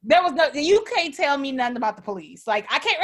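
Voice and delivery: normal talking voice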